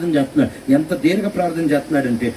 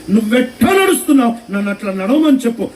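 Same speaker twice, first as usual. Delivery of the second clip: Peter pleading; 7 semitones higher than usual. Acted. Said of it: "ఏమంటున్నాడు అని అంటే".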